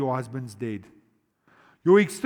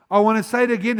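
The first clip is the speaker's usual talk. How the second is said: usual speaking voice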